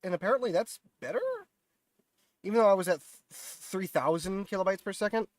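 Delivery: stuffy, nasal voice from a cold. Real, not acted. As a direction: talk through a blocked nose. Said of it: "Sorry about the nasally mu-, mucal vo-, voice".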